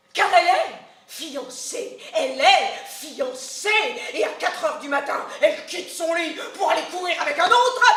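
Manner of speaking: plus fort, elle est fiancée appuyé deux fois; s'indigner haut et fort; scandale indigné. Acted. Nourrice scandalisée. (la nourrice crie au scandale, plus fort)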